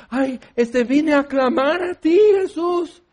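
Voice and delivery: high-pitched, flustered supplicant; mock pious plea. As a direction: plead upward, hammed up. Imita a quien responde sin saber. (súplica aguda y exagerada)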